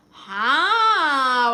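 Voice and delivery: a sudden loud, high "How?"; surprised. Real, not acted. Livestreamer reacting to a viewer's crush confession, surprised. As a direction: blurt it out in real surprise.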